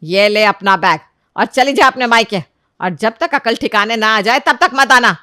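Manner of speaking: loud, forceful telling-off; shouted scolding. Acted. Mother-in-law sends daughter-in-law off to her parents, shouting. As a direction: throw her out at full volume. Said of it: "जोर से चिल्लाकर बोलती है".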